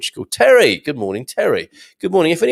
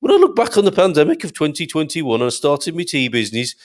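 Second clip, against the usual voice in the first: pitched-up, pompous put-on voice; Gary impression; 5 semitones higher than usual. Host imagining Gary as a tea millionaire, pompous higher voice. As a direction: boast grandly as a future tea tycoon. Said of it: "He doesn't really talk quite like that".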